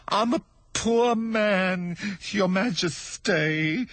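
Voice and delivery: high, slow stammering hatter; timid stuttering hatter; nervous hatter. Acted. Hatter pleading.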